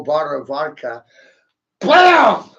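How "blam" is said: loud high "Blam!" sound effect; mock impact sound. Imitating the bottle smacking the man, loud high blam. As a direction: blurt the smack like a cartoon hit. Mimics bottle hitting head.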